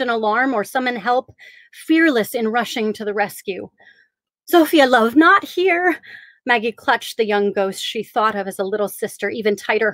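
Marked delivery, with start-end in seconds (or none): 4.5-6.0 high, urgent, alarmed plea. Acted. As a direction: stop her in sudden alarm. Maggie urgently stops Zofia.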